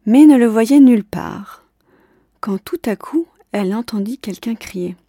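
ordinary delivery, nothing marked

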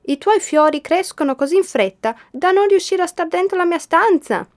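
high, loud excited shout; shouting happily. A narrator's reading. Boy shouts to the girl below about the flowers, high and loud. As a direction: yell it down with joy. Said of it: "le gridò".

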